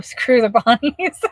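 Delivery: laughing out the words; reading the chat through giggles. Real, not acted. Reading a viewer's super chat, laughing. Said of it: "that makes me laugh so hard".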